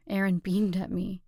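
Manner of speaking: ordinary delivery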